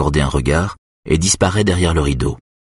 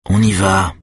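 usual speaking voice

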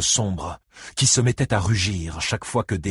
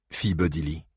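usual speaking voice

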